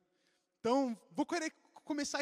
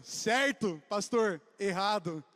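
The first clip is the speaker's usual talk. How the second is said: mock yelling; 3 semitones lower, 7 dB louder, slower than usual. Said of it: "Eu gritando".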